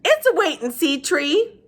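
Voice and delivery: put-on high, very slow old woman; Gloria Dump voice. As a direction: draw the name out slowly, high up. She said.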